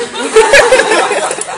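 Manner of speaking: loud, high burst of laughter; big high laugh. Real, not acted. Teller laughing at his own fan moment, high loud laugh. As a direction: crack up at yourself.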